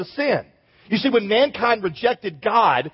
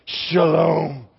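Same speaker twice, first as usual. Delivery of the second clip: lower, lingering shalom, savoured like cool water; a word that feels like cool water over your head; refreshed; 4 semitones lower than usual. Shown as a demonstration. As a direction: let Shalom pour out like a refreshing drink. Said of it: "Say it like you, like you really want to be refreshed".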